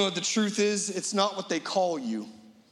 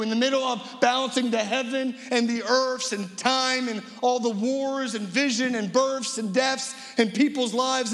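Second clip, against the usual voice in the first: slow, raised pulpit cadence hitting Jesus; preaching; 5 semitones higher than usual. Acted. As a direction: preach it with weight and certainty. Preaching climax about Jesus stopping.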